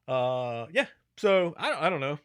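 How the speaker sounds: normal talking voice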